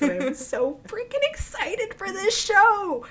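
high, drawn-out excited near-squeal; real giddy excitement. Real, not acted. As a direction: burst with excitement, pitch soaring, words stretched. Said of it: "You can hear it in my voice".

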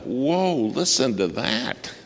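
high, drawn-out mock astonishment; retold amazement. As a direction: imitate a stunned eavesdropper, starting high. Reacts to overheard confession.

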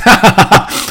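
loud, high burst of laughter; a big loud cackle. Real, not acted. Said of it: "I laugh way too loud in y'all's ear".

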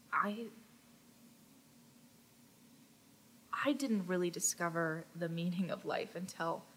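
hushed, soft romantic confession; tender whisper. Acted. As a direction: whisper it like a devoted lover. GM voices handsome figure wooing.

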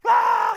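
put-on a high, loud yelp of kids' delight; excited squeal. Kids hearing the Disneyland news, high excited squeal.

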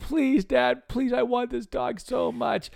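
very high, loud imitation of a begging daughter; hammed-up crying child pleading with dad; mock bawling plea. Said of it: "she's crying and bawling and begging me".

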